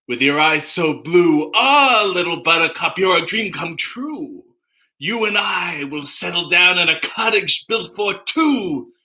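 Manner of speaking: theatrically recited lyrics as a sitcom nemesis; Newman from Seinfeld. Acted. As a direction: declaim the love song in character, without singing. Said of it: "is Newman from Seinfeld".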